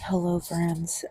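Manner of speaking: ordinary talk, nothing marked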